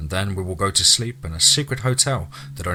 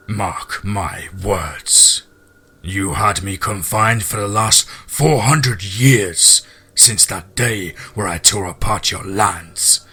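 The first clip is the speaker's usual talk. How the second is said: very slow, drawn-out monster threat; menacing werewolf; 3 dB louder, slower than usual. Acted. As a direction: vow vengeance as a caged beast, word by heavy word. Voices the monstrous wolf's threats.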